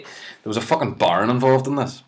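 usual speaking voice

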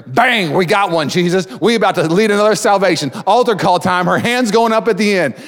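high-pitched jubilant celebration; excited. Acted. Preacher cheering another salvation at altar call, excited. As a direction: crow over the win, high and lively.

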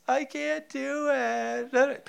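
put-on high, slow, whining child voice; whiny little daughter. Father imitating his little daughter whining at bedtime. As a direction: play the kid whining she can't. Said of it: "she says".

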